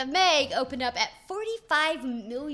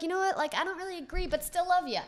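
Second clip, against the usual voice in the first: fan voice; 9 semitones higher, faster than usual. Retold or imitated. Voicing loyal fans quoted.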